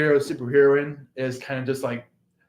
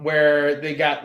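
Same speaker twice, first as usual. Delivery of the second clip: usual speaking voice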